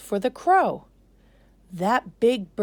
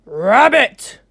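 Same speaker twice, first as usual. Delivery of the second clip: much louder, booming shout; king's cry; 5 semitones higher, 15 dB louder than usual. Acted. King Lion crying out to the rabbit, booming. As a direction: call the rabbit like an angry king. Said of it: "cried the king".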